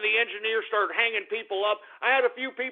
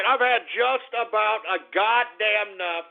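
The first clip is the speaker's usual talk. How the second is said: ordinary delivery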